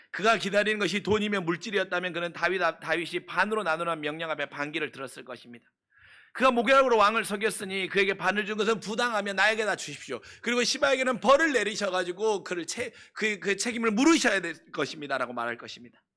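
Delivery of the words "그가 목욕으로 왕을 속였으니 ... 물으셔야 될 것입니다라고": loud, higher, insistent claim; indignant demand. Pretend. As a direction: demand it forcefully. (억울함을 크게 주장하듯)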